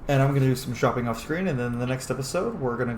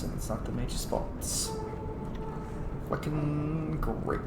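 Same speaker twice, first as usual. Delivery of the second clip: slow, softer sarcastic mutter; bitter sarcasm; 3 semitones higher, 8 dB quieter, slower than usual. Real, not acted. Gamer muttering sarcastically at the news. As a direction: say it with weary bitterness.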